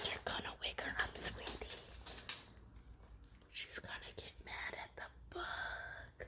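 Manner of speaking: soft whisper. Real, not acted. Owner whispering about the bug on Suki. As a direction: say it on breath. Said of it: "ever so quietly".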